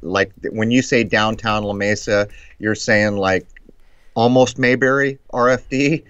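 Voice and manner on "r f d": normal talking voice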